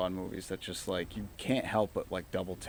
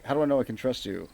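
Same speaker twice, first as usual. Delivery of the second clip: lightly imitated spy, quick and louder; Connery; 4 semitones higher, 6 dB louder, faster than usual. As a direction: fire off the question as a retold movie hero, pitched up. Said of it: "And Connery's like".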